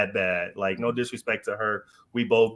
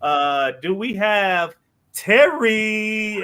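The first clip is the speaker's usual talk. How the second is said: announcer voice; 8 semitones higher, 4 dB louder, slower than usual. Retold or imitated. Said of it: "she gets out there and was like".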